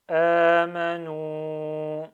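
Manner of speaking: "Amanu" very slow and loud, long vowels held steadily; emphasised prolongations. Shown as a demonstration. Teacher reads the word in one go, "Amanu" with prolongations stressed. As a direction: stretch the first a and final u of "Amanu". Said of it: "J'essaie de souligner les prolongements".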